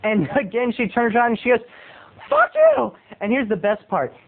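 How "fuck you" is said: high-pitched squawky mock outburst; angry moviegoer. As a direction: act an irate woman in a high voice. Acts the angry woman's outburst.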